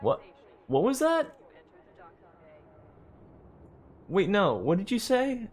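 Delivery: high, alarmed questions; suddenly startled, asking what. Real, not acted. Startled by a radio voice.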